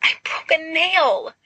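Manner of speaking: high, slow whiny whimper. Acted. Carmina complaining to Narcissa, high slow whimper. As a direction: whine it like a spoilt girl. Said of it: "Carmina whimpers".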